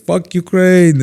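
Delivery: loud, high mock cheer; retold rowdy shout. Stranger shouting to the teller's buddy, loud and rowdy.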